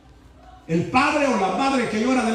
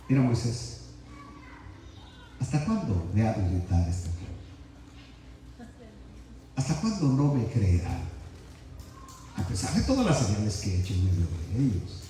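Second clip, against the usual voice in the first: much lower and softer, patient and gentle; calm, not angry; 9 semitones lower, 7 dB quieter than usual. Shown as a demonstration. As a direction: say it calmly, like a patient father. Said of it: "Jehová no está hablando como yo estoy hablando". (tranquilo, más bajo y suave, sin enojo)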